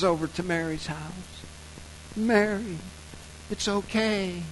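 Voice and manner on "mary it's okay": higher, sugary-soft lilt; polite dreamy Joseph. Acted. Joseph reassuring Mary after the dream, sweetly dreamy. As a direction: coo it as a mock-idyllic reassurance. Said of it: "I get this polite idea".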